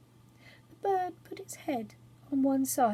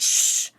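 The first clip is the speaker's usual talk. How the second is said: sharp loud hush; loud shush; 8 dB louder than usual. A narrator's reading. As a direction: shush him at once. All the others said.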